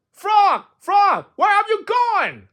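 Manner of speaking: high, loud, drawn-out wail; screamed call. Acted. Toad searches for vanished Frog, high slow scream. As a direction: scream for a missing friend. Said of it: "screamed Toad".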